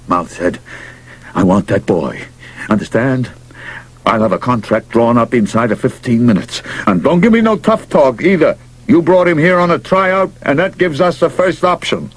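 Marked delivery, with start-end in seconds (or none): 1.4-12.1 high, brash baseball boss voice; gruff pushy manager. Acted. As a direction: push hard like a desperate manager.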